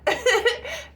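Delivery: high loud laugh; short loud high laugh; burst of laughter. Real, not acted. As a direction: crack up at herself. Laughs at her own boast.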